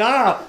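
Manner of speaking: high, very loud mock cry; imitated loud "ah". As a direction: belt out a startled-sounding "ah". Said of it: "he just kinda like that".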